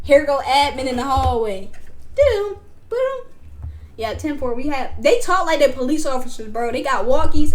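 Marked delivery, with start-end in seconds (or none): 2.1-3.3 walkie beeps imitation. Imitating walkie radio sound.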